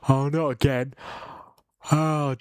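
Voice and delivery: words stretched by a real yawn. Real, not acted. Streamer yawning live on his watch-along. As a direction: groan it out mid-yawn. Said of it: "That's three yawns now".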